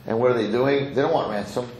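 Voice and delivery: plain reading voice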